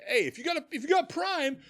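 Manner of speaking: high-pitched mock streamer plug; squeaky sales patter trailing into babble; pushy streamer. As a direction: parody a streamer begging for subs. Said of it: "a streamer will be like".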